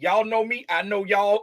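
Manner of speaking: usual speaking voice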